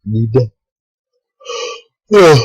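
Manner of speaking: yawning mid-sentence; tired, yawning through the words. Real, not acted. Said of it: "Don't mind my yawning".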